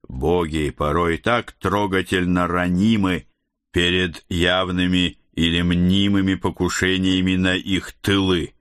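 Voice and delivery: usual speaking voice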